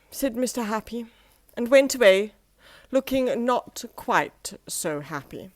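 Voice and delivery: ordinary delivery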